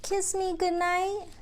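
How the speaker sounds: slow, sing-song picture book reading; reading to children. Acted. Teacher reads a picture book aloud to the kids. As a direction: read it gently to little ones.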